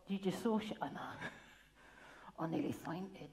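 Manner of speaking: usual speaking voice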